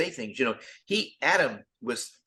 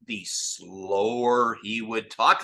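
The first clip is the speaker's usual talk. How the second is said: slowed right down; slow talk; 3 semitones lower, much slower than usual. Shown as a demonstration. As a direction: drag the words out slowly. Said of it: "it was so funny because".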